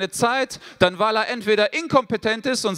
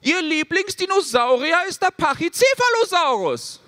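exasperated son; 7 semitones higher than usual. Retold or imitated. Son to father about the girl, higher indignant whine. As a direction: spell it out like it's obvious. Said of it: "Ich sag".

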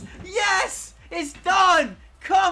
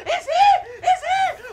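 very high hysterical cry; real overjoyed screaming; ecstatic scream; 12 semitones higher, 3 dB louder than usual. Real, not acted. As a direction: shriek that it has finally come. Long-awaited shiny appears.

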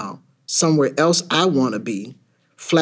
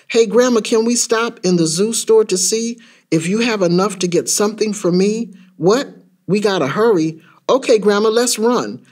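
put-on higher-pitched eager kid calling to Grandma; excited child; 5 semitones higher than usual. Child begging Grandma to stop at the zoo store, excited. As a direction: plead like a kid who can't wait.

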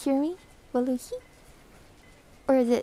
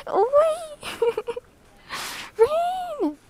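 high excited squeal; 13 semitones higher, much slower than usual. Real, not acted. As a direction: squeal the name with delight. Greeting a viewer excitedly.

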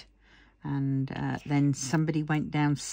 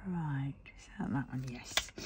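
soft whisper to herself; 9 dB quieter than usual. Real, not acted. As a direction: whisper it under your breath. Muttering to herself while crafting.

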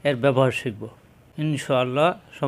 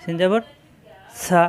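ordinary delivery

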